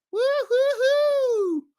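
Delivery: long, high-pitched whoop; drawn-out falsetto cheer; excited woo-hoo. Real, not acted. Host cheers during viewer greetings.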